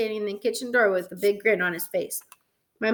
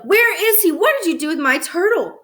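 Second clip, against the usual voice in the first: high, loud angry demand; yelling; 8 semitones higher, 4 dB louder than usual. A narrator's reading. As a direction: yell at a little brother for answers. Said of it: "I yelled".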